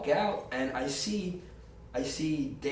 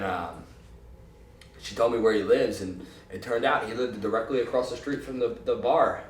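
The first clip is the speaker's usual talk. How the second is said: normal talking voice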